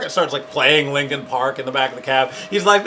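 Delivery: ordinary talk, nothing marked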